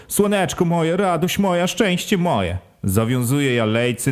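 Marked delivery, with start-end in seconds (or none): 0.1-2.6 higher keening voice for the wife; mourning mother's endearments. Acted. Wife lamenting over her dead baby, higher keening. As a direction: croon the pet names, higher, as a lament.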